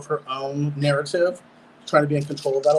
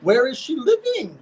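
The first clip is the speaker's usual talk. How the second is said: incredulous; 8 semitones higher, slower than usual. Real, not acted. Outraged at rent price.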